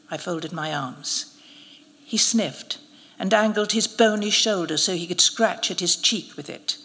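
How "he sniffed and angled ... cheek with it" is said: usual speaking voice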